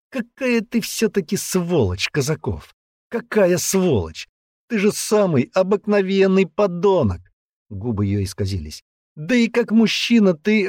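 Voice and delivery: put-on high-pitched, contemptuous girl's voice; scornful girl. Girl berating her former lover, high scornful anger.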